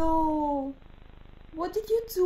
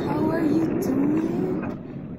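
Pomni voice; 3 dB louder, slower than usual. Acted. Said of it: "It's Pomni again".

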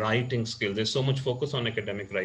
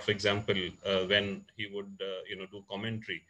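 normal talking voice

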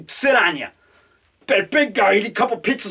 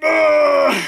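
flexing grunts; 4 semitones higher, slower than usual. Acted. Flexing, showing off body.

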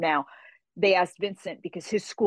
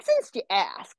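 teasing; 4 semitones higher, slower than usual. Retold or imitated. Retelling the judge's teasing reply.

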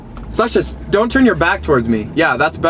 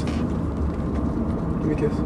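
slow, breathy whisper; 2 semitones lower, 11 dB quieter, much slower than usual. Acted. Owner coaxing his dog Sasha for a kiss, slow whisper. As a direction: coax a kiss in a slow hush.

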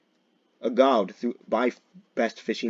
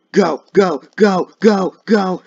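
high, loud repeated bursts; urgent shouting; 8 semitones higher, 12 dB louder, slower than usual. Pretend. Player racing after a suspect, urgent shouting. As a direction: yell to spur on the chase. Urging on the chase.

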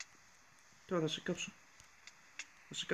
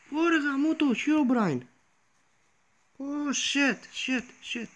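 panicked cursing; 8 semitones higher, slower than usual. Real, not acted. Herobrine appears again.

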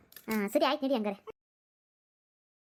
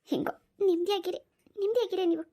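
higher, cutting sarcastic jab; bitter sarcasm; 6 semitones higher than usual. Acted. Wife to husband who neglects her, bitter sarcasm. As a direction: throw it back at him, hurt and mocking.

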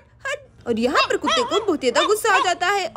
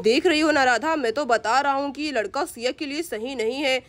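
lower, disapproving grumble; grumbling father; 7 semitones lower than usual. Acted. Father telling Radha the boy is careless, lower grumble.

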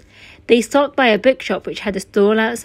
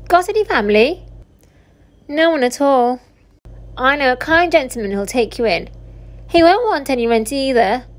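cheeky young pickpocket, lightly played, higher and lively; lightly voiced street boy; 3 semitones higher than usual. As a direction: offer help like a streetwise lad, lightly voiced. Dodger, a street boy, befriends Oliver.

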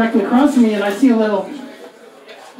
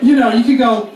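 normal talking voice

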